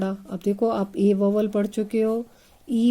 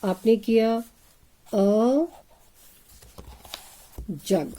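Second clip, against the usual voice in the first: slow dictation; much slower than usual. Shown as a demonstration. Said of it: "अब fastly करते हैं".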